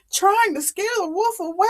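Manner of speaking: ordinary delivery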